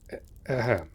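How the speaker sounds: loud stammered throat clear; startled awkward cough; flustered ahem. Acted. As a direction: cover embarrassment with a stuttered ahem. Flustered after the kiss.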